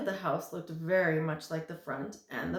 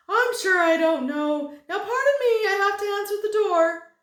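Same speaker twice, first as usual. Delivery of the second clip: very high, loud, fast chatter; giddy thin man; 14 semitones higher, 14 dB louder than usual. Acted. Thin man gushes to Milo, high and fast. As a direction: gush without stopping for breath.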